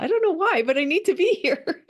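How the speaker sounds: Teresa, eager. Acted. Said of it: "kind of like Teresa".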